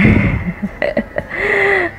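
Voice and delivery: a high, bright burst of real laughter. Real, not acted. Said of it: "Aku cukup ketawa sih". (tawa geli spontan)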